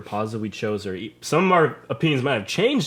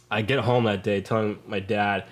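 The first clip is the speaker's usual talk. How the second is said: ordinary talk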